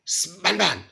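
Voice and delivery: high, loud call of a name. Acted. Preacher imitates calling an inattentive child, loud calling out. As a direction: raise your voice sharply to get their attention.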